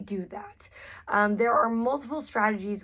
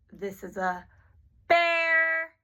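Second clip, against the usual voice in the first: "bear" much louder and higher, drawn out; loud stress on BEAR; 8 semitones higher, 4 dB louder, slower than usual. Shown as a demonstration. Therapist highlighting the key word for children, "bear" said loud and high. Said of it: "my volume went way up there".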